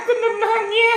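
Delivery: crying-laughing shout; happy laugh demo. Shown as a demonstration. Teacher demonstrates a happy laugh for the kids. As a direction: laugh it out half sobbing. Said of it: "Itu bukan tertawa bahagia".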